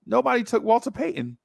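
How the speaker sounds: high, knowing voice of the neighbor; neighbor tipping him off. Retold or imitated. Neighbor telling the pouting kid about a player, high. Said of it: "He was like".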